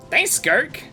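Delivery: ordinary talk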